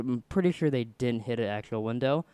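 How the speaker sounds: ordinary talk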